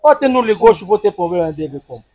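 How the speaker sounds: usual speaking voice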